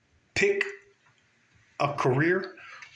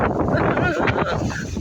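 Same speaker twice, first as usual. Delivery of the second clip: high burst of laughter at the wind; startled laugh; 13 semitones higher, 3 dB louder than usual. Real, not acted. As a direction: laugh in surprise, caught off guard. Wind hits during video.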